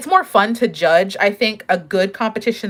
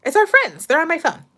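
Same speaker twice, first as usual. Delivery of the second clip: higher, sing-song voice for her mom; talking to mom; 8 semitones higher than usual. Real, not acted. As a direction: explain gently to mom who walked in.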